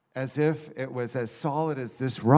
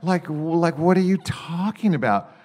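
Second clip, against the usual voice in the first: exasperated; 6 semitones higher, 5 dB louder than usual. Retold or imitated. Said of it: "I go".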